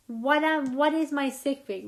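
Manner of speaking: ordinary delivery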